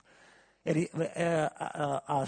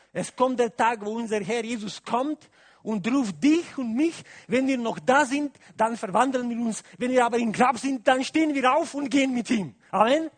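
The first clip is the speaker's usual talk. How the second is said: louder, higher preaching at full force; 8 semitones higher, 9 dB louder than usual. Acted. Preacher stirring the congregation about the resurrection, full-force preaching. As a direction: drive the point home to the congregation.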